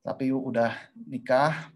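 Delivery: ordinary talk, nothing marked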